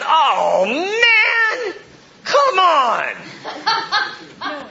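loud, high drawn-out mock whine; exasperated outburst. Preacher imagining his own reaction to the crowd, exasperated.

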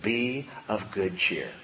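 slow, soft, tender reassurance; gently and slowly spoken cheer; soft encouragement. Shown as a demonstration. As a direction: comfort someone who is down. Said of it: "all I need when I'm feeling down is to hear Jesus say".

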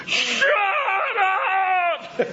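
long, very high-pitched scream; imitated screaming meltdown, drawn out; Arnold Schwarzenegger losing control. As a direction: lose it in a stretched-out movie yell. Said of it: "he finally loses control".

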